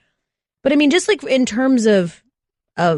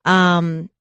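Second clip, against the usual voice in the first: usual speaking voice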